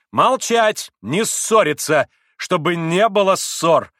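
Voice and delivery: loud, high, drawn-out commands; imperious shout. A narrator's reading. Grushenka stops the men quarrelling, shouting imperiously. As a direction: order them to stop. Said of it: "крикнула повелительно Грушенька".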